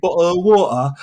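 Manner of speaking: hammed-up London accent, dropping the t's. Shown as a demonstration. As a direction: lay the London accent on thick. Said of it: "getting rid of certain London parts".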